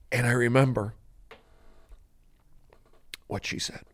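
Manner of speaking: hushed recollection with long pauses; hammed-up soft reminiscence; mock-poignant. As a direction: trail away into near-whisper, milking the memory. Winding up mock emotional monologue.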